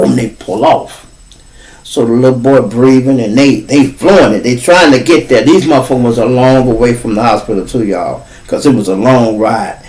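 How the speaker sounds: usual speaking voice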